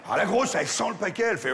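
ordinary talk, nothing marked

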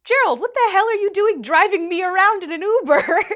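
high, loud mock disbelief; incredulous outburst. Rider stunned her professor driver does Uber, loud incredulous outburst. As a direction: blurt it out, hammed-up astonishment.

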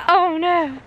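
alarmed. Retold or imitated. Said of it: "I was like".

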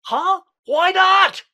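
high, loud incredulous yelp; outraged outburst. Acted. Caller refused by the receptionist, outraged outburst. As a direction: explode in high-pitched disbelief.

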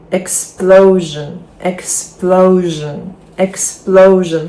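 "Explosion" slowly, PLO stretched; stress before the ending. Shown as a demonstration. Teacher drilling for repetition, slow stress on PLO in "Explosion". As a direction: hit ex-PLO-sion hard and slow. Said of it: "наголос падає на попередній перед цим закінченням".

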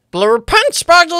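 shout and cackle. Pretend. Character shout after Stitch.

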